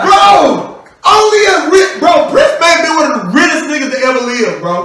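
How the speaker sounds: loud, fast, high laughing rant; excited shout. Real, not acted. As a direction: hype it up in disbelief. Excited rant about [name].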